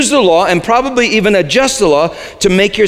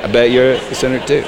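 teasing; 6 semitones lower, faster than usual. Shown as a demonstration. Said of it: "Go ahead and say that".

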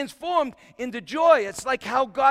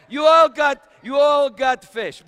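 louder and higher, a talk-show host giving prizes away; Oprah-style giveaway; 5 semitones higher, 9 dB louder than usual. Retold or imitated. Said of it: "I'm like Oprah".

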